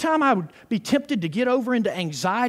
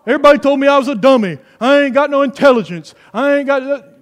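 loud, higher hammed-up self-pitying dummy; self-declared dummy; 4 semitones higher, 9 dB louder than usual. Preacher acting out someone who thinks himself stupid, loud mock dummy voice. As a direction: play the dummy, loudly running yourself down. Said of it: "don't talk like that".

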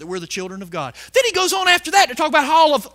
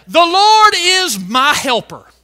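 loud, slow, higher proclamation; bold declaration; 4 semitones higher, 6 dB louder, slower than usual. Shown as a demonstration. Preacher showing what believers may boldly say, bold. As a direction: declare it boldly, stretching the words. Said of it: "we may boldly say".

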